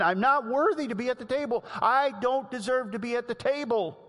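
mild imitation of the begging mother; the humble woman. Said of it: "this is the woman".

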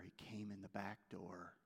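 ordinary delivery

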